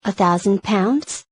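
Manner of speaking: usual speaking voice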